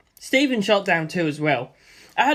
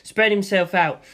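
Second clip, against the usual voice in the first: plain reading voice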